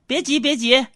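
high-pitched, impatient mother; acted motherly fussing; mom's voice. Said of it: "我妈说".

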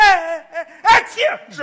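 loud faked sneeze breaking into sounds; mock sneezing shout. Teacher fakes a sneeze to avoid phonetics, loud. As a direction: fake a big sneeze to dodge the lesson. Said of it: "我一听国际音标".

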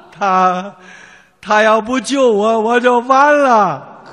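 lightly voiced old man, drawn out at the end; slow old man. Old man finally explaining to his son, slowly. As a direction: drag the last words like a breathless grandpa.

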